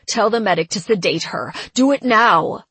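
fast and loud, rising to a shout; urgent shouted order. Acted. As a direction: bark the command with urgency. Urgent order to sedate her.

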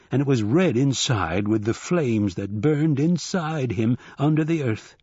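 usual speaking voice

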